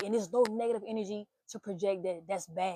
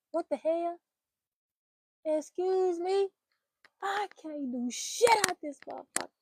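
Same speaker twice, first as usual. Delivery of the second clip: high, drawn-out, offended excuse me; disbelief, pitched well up; incredulous attitude; 9 semitones higher than usual. Acted. Reacting to a man's tantrum with offended disbelief. Outraged reaction to his anger.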